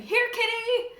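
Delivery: loud, high call for the cat; frantic teacher hollering for a cat. A narrator's reading. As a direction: holler it across the classroom.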